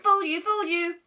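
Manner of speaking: high, loud sing-song jeer; gloating taunt. Acted. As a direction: rub it in, gleefully. Margaret gloating.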